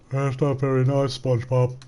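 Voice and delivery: lightly voiced dim cartoon sidekick; a hint of a cartoon starfish; Patrick Star. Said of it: "He acting like Patrick Star".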